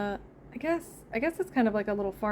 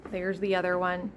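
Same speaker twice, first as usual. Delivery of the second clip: normal talking voice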